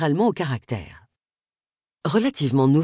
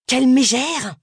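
louder, higher indignant jab; hissed insult; 8 semitones higher, 5 dB louder than usual. Acted. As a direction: spit out the insult in outrage. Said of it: "siffla-t-elle".

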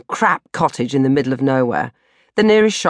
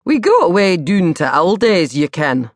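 put-on Scottish accent; 2 semitones higher, 3 dB louder than usual. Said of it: "Honestly, he said that".

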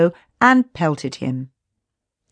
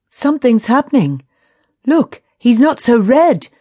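relieved; 6 semitones higher, 6 dB louder than usual. A narrator's reading. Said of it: "the relief in his voice obvious".

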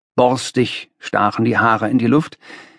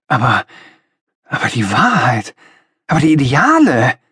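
raised voice; 2 semitones higher, 4 dB louder than usual. A narrator's reading. Young idealist objects to the master, raised voice. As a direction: burst out louder than is proper. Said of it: "rief ich lauter, als schicklich war".

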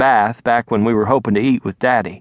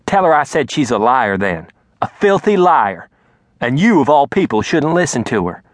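angry mother; 3 semitones higher than usual. Acted. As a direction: spit out filthy liar in a rage. Mama angry on the phone.

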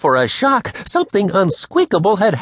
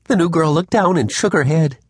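plain reading voice

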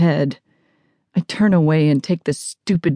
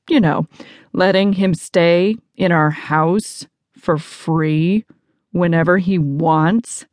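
usual speaking voice

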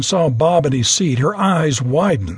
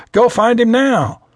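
louder, higher, firm command; a pilot snapping out a curt order; stern order; 6 semitones higher, 4 dB louder than usual. A narrator's reading. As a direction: order her to go right now. Captain orders attendant to find pilot.

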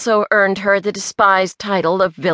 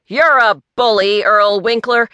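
louder, raised accusation; accusing; 4 semitones higher, 5 dB louder than usual. A narrator's reading. Pete confronts Earl as a bully, loudly accusing. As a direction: hurl it as an open charge. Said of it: "Pete accused".

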